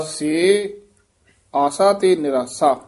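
long pause separating ਸੇ from ਆਸਾ; break after ਸੇ. Shown as a demonstration. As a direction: say ਸੇ, wait, then finish the phrase. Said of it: "ਸੇ ਤੇ ਯਮਕ ਲੱਗ ਜੇਗੀ".

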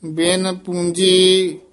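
slow, measured chant with held endings; teacher's deliberate Gurbani reading; scripture recitation. Acted. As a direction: intone sacred verse phrase by phrase.

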